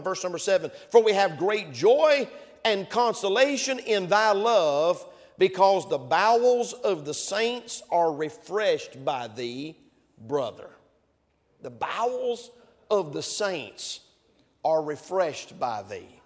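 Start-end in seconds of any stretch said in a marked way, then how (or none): 0.9-10.7 slow, solemn King James reading; reading scripture slowly. Shown as a demonstration. Preacher reading Paul's letter to the church, solemn and slow. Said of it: "Paul said it like this". 11.6-16.2 The bowels of the saints, each chunk set apart with pauses; slow repeated phrase. Shown as a demonstration. Preacher repeating the verse for emphasis, slow with pauses. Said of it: "you know why I said".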